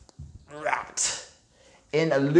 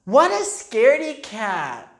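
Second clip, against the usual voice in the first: high, loud mocking girl; teasing girl; 7 semitones higher, 6 dB louder than usual. A narrator's reading. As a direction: taunt him for being scared. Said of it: "said Francine".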